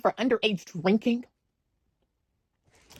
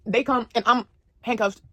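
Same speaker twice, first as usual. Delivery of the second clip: ordinary talk, nothing marked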